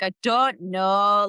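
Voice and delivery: slow, drawn-out Northern English impression; mock Northern accent. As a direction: tease a friend with an exaggerated Northern accent. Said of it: "I constantly make fun of my friends who are Northern".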